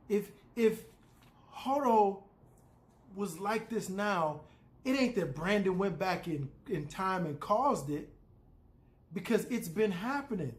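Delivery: losing cool. Real, not acted. Fan ranting over a baffling show theory, losing cool. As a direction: insist on it, pitch climbing as composure slips. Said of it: "I lost my cool right there".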